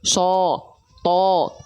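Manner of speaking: "So. To." held long with an O-like vowel; thick letters. Shown as a demonstration. Teacher asks how these are read, thick letters. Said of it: "Ada nggak yang dibaca".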